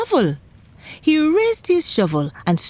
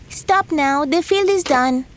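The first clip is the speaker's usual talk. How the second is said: spider ordering; 7 semitones higher than usual. Acted.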